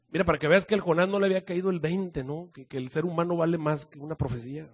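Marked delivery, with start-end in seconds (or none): none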